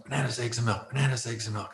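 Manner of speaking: hushed, rote memorizing mutter; muttering to himself. Retold or imitated. Man memorizing his wife's grocery list, muttering to himself. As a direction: recite it softly under your breath.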